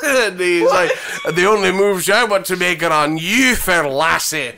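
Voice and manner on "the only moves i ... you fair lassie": Bond's hammed-up slurred Scottish accent. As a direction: flirt in a mock Scottish brogue. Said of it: "Oh, a Scottish accent".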